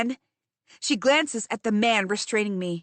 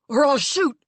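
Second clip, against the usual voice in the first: shouted threat; 3 semitones higher, 4 dB louder than usual. Acted. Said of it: "shouts one of the guards".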